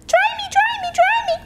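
put-on very high pleading baby voice; crying baby chick. Soggy chick to Jack, high baby cry. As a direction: squeal the demand, tiny and high. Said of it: "cried the soggy baby chick".

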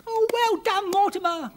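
high, loud delighted cheer; delighted call. Acted. Girl cheering her bird for helping, delighted. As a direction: cheer the bird on at full pitch. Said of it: "called Arabelle".

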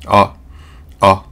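あ short and dull, a relaxed 'uh'; muted, brief 'uh' demonstration; dark 'a'. Shown as a demonstration. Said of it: "暗いあってやつ".

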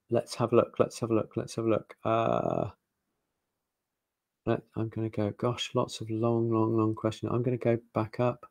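soft, absent-minded murmur; under your voice, half to yourself; talking to self. Real, not acted. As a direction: think aloud quietly while scrolling through questions. Scanning questions, talking to self.

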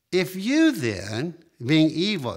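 slow, loud, weighty scripture reading; solemn emphasis. Acted. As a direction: lean on each word. Reading Christ's words with weight.

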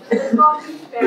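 speaking up. Real, not acted. Audience member repeating their opener after being asked, speaking up. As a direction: project so the whole room hears. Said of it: "please speak up?".

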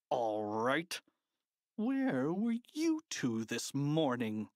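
a mild growl from the stern principal; lightly growled, irritated; understated principal's growl. A narrator's reading. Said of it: "he growled".

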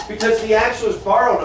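normal talking voice

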